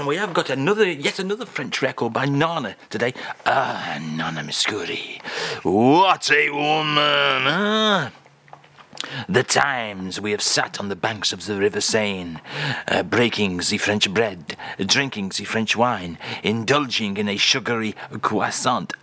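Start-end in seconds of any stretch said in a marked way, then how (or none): 3.4-8.1 mock French accent. [name] sighs over [name], in a mock French accent. 9.3-18.9 lower, dreamy French reminiscence; mock French accent. [name] recalls the banks of the Seine, in a mock French accent.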